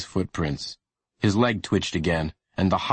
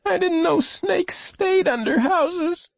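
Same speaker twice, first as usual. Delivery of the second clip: put-on high, slow child voice; high-pitched, drawn-out, pained; weak hurt boy; slower than usual. A narrator's reading. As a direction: labor through the words as an injured boy. Injured boy explains himself.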